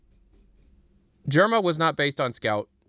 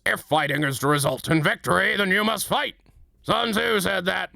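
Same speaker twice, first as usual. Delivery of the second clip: a hint of a barking army man, a bit higher; Soldier impression; 8 semitones higher, 3 dB louder than usual. Streamer quoting his favorite line, light mock soldier bark. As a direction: shout it like a self-sure drill soldier. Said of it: "my favorite soldier voice line is".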